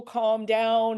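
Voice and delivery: loud, blunt "Calm down" as an order; commanding calm down. Shown as a demonstration. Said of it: "if we ever tell people".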